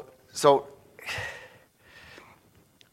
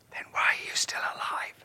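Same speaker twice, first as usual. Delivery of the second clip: whispered retort; 10 dB quieter than usual. Acted. God's aside whispered.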